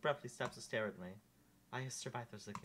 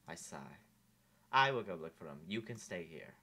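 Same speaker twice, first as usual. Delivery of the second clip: usual speaking voice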